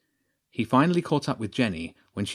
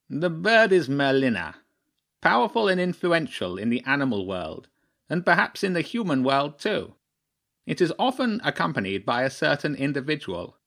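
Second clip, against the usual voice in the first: higher, formal crow chairman revealing a secret; pompous bird hinting with relish at powerful figures; crow chairman, ominous; 5 semitones higher than usual. Acted.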